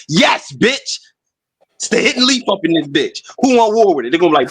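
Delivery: ordinary talk, nothing marked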